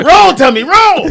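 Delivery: high, loud laughing chant; hammed-up battle cry. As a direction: yell it like a fight cue. Kung fu movie parody.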